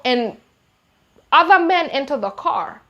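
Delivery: usual speaking voice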